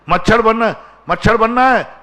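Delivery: high, loud rhetorical challenge; challenging question. Real, not acted. Preacher loudly questioning the congregation.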